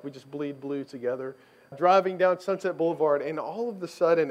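ordinary talk, nothing marked

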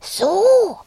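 loud, high drawn-out huff of a little dragon; breathed out. A narrator's reading. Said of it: "hauchte Rüdiger".

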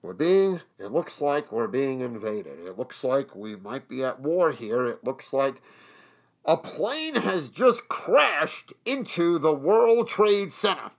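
low, hushed newsbreak voice; halting, subdued imitation of an emergency broadcast; radio news bulletin. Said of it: "they break in. And it's like".

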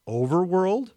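incredulous rising tune on Overworld; mock-puzzled question. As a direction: query "Overworld?" with a steep upward glide. Witch feigns ignorance of the word.

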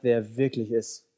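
normal talking voice

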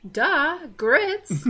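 put-on a Southern girl retorting that grits is the obvious choice; duh. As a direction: act like the answer is too obvious to ask. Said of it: "And it's like".

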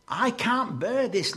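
high, loud cry of someone overwhelmed; acted anguished plea; desperate prayer. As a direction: cry it out to God at breaking point. Acting a desperate prayer to God.

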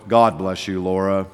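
low, solemn pulpit voice; preacher voice. Retold or imitated. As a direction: put on the formal pastor voice. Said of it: "I put on my preacher voice".

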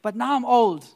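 high, loud proclaiming of the psalm; preaching scripture. Acted. Quoting David to the congregation, preaching loudly. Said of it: "David tells us, he says".

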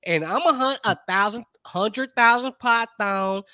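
hammed-up Southern country speech; Amy Sue. Snake huntress explains her python hunting, thick Southern twang. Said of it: "My name Amy Sue".

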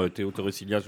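ordinary talk, nothing marked

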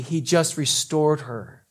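ordinary delivery

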